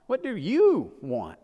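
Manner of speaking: "you" lifted high and drawn out; slow question pointed at "you"; stress on YOU. Shown as a demonstration. Pastor testing where the emphasis goes, stress on YOU. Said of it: "where do you put the emphasis here".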